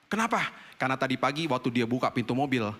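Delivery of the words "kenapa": high startled "Kenapa?"; shocked question. Retold or imitated. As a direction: react in alarm. Shocked reaction retold. (kaget dengan nada tinggi)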